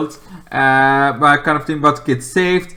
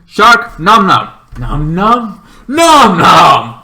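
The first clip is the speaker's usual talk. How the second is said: louder, slow nom nom munching; playful munching voice; 4 semitones higher, 9 dB louder, much slower than usual. Retold or imitated. King Shark trying to eat the monster, slow munching nom nom. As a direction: chew out each nom like a hungry shark.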